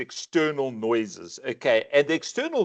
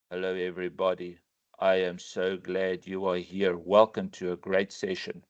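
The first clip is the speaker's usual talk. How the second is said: dull greeting; 8 semitones lower, 5 dB quieter than usual. Shown as a demonstration. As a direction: greet them as if you couldn't care less. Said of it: "Imagine I started this session today this way".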